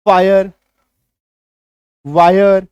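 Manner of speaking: फायर and वायर said loud as आ plus य; loud demo words. Shown as a demonstration. Teacher showing class the आय sound, loud. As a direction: call out फायर, वायर loudly, stressing the आय sound. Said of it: "आकार की मात्रा य".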